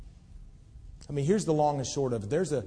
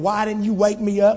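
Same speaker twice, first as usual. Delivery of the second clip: loud, high angry demand at the porter; furious retold yell; 9 semitones higher, 12 dB louder than usual. Salesman yelling at the porter who let him sleep, furious. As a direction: shout it like a salesman who overslept. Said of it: "gets so mad".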